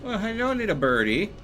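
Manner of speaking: cutesy greeting. Acted. Greeting a bird in game.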